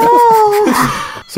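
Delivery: very high, slow, squeaking with laughter; laughing hard. Real, not acted. Player offering quizmaster a silly guess, laughing hard. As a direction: barely get the guess out.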